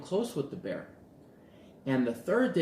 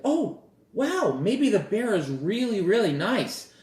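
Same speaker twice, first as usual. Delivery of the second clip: high, loud mock-gushing wonder; hammed-up starry-eyed enthusiasm; naive delight; 9 semitones higher, 7 dB louder than usual. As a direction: gush as if suddenly won over. Acts naive delight about the bear.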